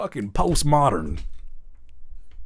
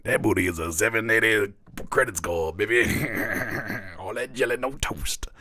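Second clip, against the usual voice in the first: put-on low, soft old-head uncle line; mock unk flirting; 4 semitones lower, 6 dB quieter than usual. Mocking the unk pickup line, low and soft. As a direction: croon it low like a corny uncle. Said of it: "What the fuck was that".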